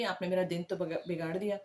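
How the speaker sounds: ordinary talk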